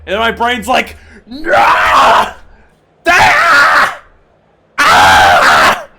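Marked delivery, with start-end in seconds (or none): 1.3-5.9 long, full-force panicked screams; brain screaming. Acted. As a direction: wail wordlessly at the top of your voice. Acts out his brain screaming.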